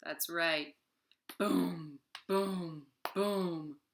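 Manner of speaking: ordinary delivery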